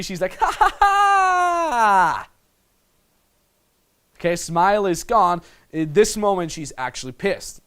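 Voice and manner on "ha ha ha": loud, high, drawn-out fake laugh; mock giggling. Coach playing the girl in slow motion, mock giggly laugh. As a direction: exaggerate her giggle as one long high cackle. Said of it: "She's all giggly".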